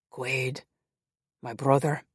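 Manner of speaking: a light Scottish Highland brogue, low; lightly voiced Highland brother, warm greeting. A narrator's reading. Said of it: "The brogue was masculine and deep".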